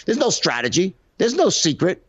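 imitating sales trainer. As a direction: parody a pushy trainer. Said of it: "He's like".

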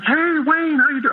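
acting out an outgoing man's hello; gregarious friend. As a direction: hail an old pal in an imitated big, high voice. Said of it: "he's very gregarious".

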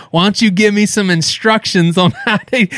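condescending. Retold or imitated. Uncle patronizing nephew who gave only an address, condescending. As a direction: talk down as if to a child. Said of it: "he was talking down to me like I was immature".

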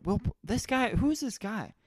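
high, wondering who is this; curious double take; puzzled surprise. Retold or imitated. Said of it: "I was like".

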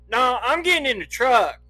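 high, loud acted refusal; loud defiance. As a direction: announce it forcefully, brooking no argument.

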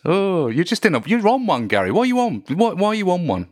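high sing-song teasing. Real, not acted. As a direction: tease him in a high voice. Teasing Gary about his mood.

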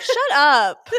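laughing mock snap, loud and high; Andy Cohen voice. Dismissing Bravo fans to her co-host, in a laughing Andy Cohen voice. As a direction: bark it like a catty host through a laugh. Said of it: "I'm doing my Andy Cohen voice".

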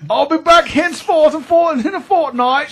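high, loud mock Cockney chimney sweep. Dad teased as a chimney sweep, in a high, loud mock Cockney accent. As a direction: ham it up like a stage chimney sweep. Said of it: "You're a chimney sweep all of a sudden".

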